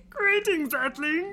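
a better Martian voice; Marvin the Martian. Retold or imitated. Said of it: "That's much better".

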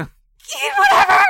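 slow, very high screech; exasperated scream. Retold or imitated. Mom gives in about cheese, retold drawn-out scream. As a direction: give up and scream it out. Retold mother at breaking point.

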